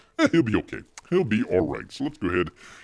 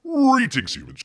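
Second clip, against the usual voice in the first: bear persona greeting; 4 dB louder than usual. Pretend.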